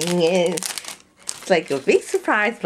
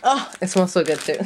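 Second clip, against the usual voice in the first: usual speaking voice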